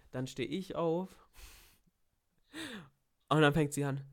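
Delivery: ordinary talk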